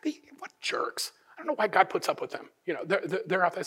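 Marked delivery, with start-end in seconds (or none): 0.0-2.5 hushed, indignant griping behind someone's back; half-whispered complaint; grumbling rumblings. Acted. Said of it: "almost hear the rumblings".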